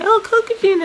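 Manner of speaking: high mock child's call of Kokujin; mock kid calling out. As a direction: imitate a little kid pointing and calling out. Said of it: "they'll just say".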